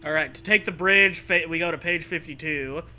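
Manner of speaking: ordinary talk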